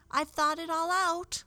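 high, bright little doll voice; high rag doll voice. Acted. Raggedy Ann's first line.

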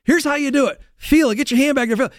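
high-pitched imitated hands-on coaching; coach correcting. Coach showing player the move mid-game, imitated urgent correction. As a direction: show a player how, urgently. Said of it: "He's correcting me real quick in game".

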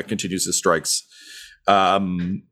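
ordinary talk, nothing marked

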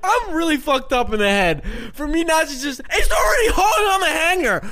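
high, loud, laugh-choked telling; laughing through it. Real, not acted. Confessing the hanger story to his friend while laughing hard.

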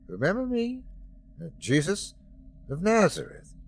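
a kind reassurance at the bedside; gentle greeting. Acted. Jesus greets narrator gently.

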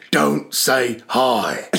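very slow, word-by-word warning; mock stern teacher. Teacher right in a pupil's face, slow mock menace. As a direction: space out each word as a no-nonsense warning. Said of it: "no nonsense".

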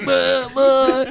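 put-on high wordless old-man bleats. Comic as the confused singer waiting backstage, high babbling bleats. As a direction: blurt confused high noises. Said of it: "Like, literally, he's like".

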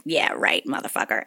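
low, soft, trailing into unvoiced; muttered dismissal. Rider to the thief, muttered dismissal. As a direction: mutter it dismissively under your breath.

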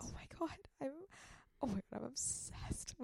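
breathless whispered gush; starstruck whisper. Real, not acted. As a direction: whisper it like a secret crush. Starstruck whisper about crush.

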